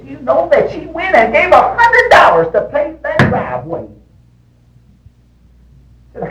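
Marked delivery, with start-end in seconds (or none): 0.0-3.9 high-pitched, rising louder; high mock-outraged retelling; retold wife's outburst. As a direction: imitate an indignant wife in a high voice. Retelling wife's indignant outburst about money.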